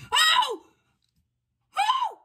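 very high, sudden shocked "oh"; shocked squeal. Real, not acted. Card opener seeing a rare pull, shocked squeal.

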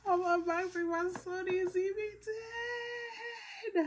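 very high, thrilled sing-song announcement; giddy high-pitched announcement; excited squeal. Real, not acted. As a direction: squeal it out like you can't contain it. Overexcited unboxing intro.

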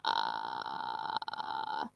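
low creaky fry on ah; vocal fry. Shown as a demonstration. Teacher models vocal fry for the class. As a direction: creak it out deep in the throat. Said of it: "Pueden hacerlo, intentarlo ustedes también".

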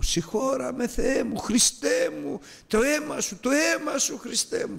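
very high, pleading retold cry; crying out for forgiveness. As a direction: reenact grandma's tearful plea, high and drawn out. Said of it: "Να φωνάζει η γιαγιά".

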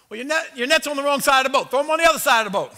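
loud advice. Retold or imitated. Jesus instructing fishermen.

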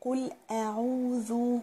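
قل with a clear qaf and a rounded damma, very slow; slow careful tajweed demo; clear qul. Shown as a demonstration. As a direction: stretch قل أعوذ slowly, every vowel shown. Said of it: "وأبين التوسط بتاع اللام".

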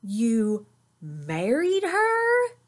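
jaw-dropped, stretching every word; shocked disbelief. A narrator's reading. Brother stunned by Papa's confession, slow amazed question. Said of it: "Brother's mouth dropped open".

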